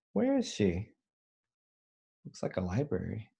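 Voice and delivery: usual speaking voice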